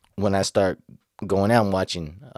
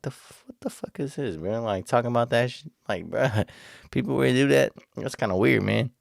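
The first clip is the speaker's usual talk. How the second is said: raised-pitch incredulous "what the fuck" with a laugh; disgusted disbelief; 5 semitones higher than usual. Retold or imitated. To the guys bragging about it, disgusted and incredulous. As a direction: recoil in mock disgust, voice jumping up.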